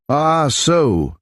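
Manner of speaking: put-on light German accent, lifted high on "Ah, so"; a touch of German accent, remembering. A narrator's reading. Von Inwald recalls the ball, in a light German accent. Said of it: "one with a strong German accent".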